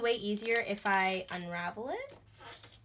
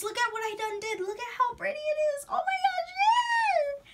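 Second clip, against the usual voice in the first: very high, fast, shrieking with joy; ecstatic squeal; 6 dB louder than usual. Real, not acted. Vlogger screaming with joy at her finished lights. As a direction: scream with delight at the result.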